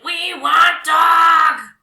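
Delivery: loud, slow, high troll shout; troll bellow. Acted. Five trolls demand the dog, slow loud bellow. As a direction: bellow each word like a hungry troll. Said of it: "they bellowed".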